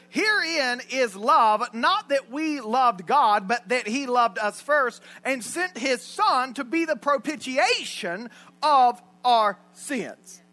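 slow, loud, emphatic Bible reading; scripture reading. Acted. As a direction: proclaim each phrase slowly.

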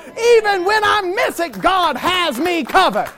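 building from firm to full-throated preaching shout; defiant shout. Acted. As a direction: rise up and roar defiance at the enemy. Preacher defies the enemy, building.

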